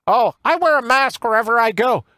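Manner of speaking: high, whiny mock politician voice; a put-on pompous politician voice, pitched well up; mocking Chucky Schumer. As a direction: lampoon a senator's smug boast. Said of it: "here's Chucky Schumer".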